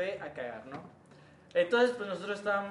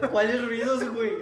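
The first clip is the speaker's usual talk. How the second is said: baffled question; 4 semitones higher, 8 dB louder, slower than usual. Retold or imitated. Friends' baffled retold question.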